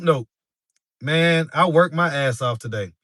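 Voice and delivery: ordinary talk, nothing marked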